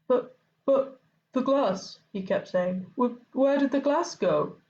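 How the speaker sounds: high, stammering disbelief; flustered keeper in shock. Acted. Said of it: "was in shock".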